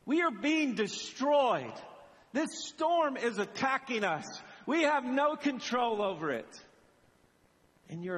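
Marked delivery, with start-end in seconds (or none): 0.1-6.6 high, pressed accusing cry; aggressive rebuke. Acted. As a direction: protest urgently as a disciple in the storm. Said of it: "to be aggressively insulting to the".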